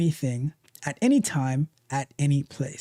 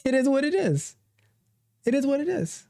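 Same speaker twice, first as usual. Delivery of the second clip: shrugging acceptance; 7 semitones higher than usual. Retold or imitated. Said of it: "Or you're like".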